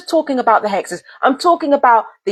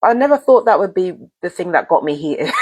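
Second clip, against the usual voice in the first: rueful laugh; 6 semitones lower than usual. Real, not acted. Laughs at own anger.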